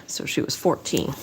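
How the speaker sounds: hushed, breathy whisper. Real, not acted. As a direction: drop to a grim whisper. Grim correction of victim's age.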